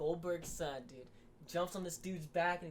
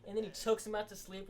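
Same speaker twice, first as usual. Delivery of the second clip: normal talking voice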